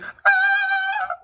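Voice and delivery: very high shrill fake scream; mock fangirl scream. Imitating a streamer girl seeing Dream's face, high fake scream. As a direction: squeal like a starstruck girl on stream. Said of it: "Like fucking screaming".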